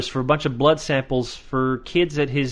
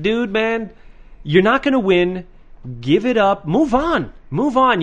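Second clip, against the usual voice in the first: exasperated urging; 7 semitones higher than usual. Acted. Urges him to give up.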